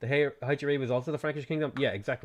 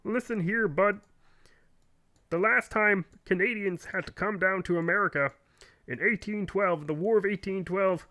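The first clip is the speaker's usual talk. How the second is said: put-on higher Canadian accent, lecturing and smug; Jordan Peterson impression; 7 semitones higher than usual. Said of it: "It's a Jordan Peterson Canadian accent".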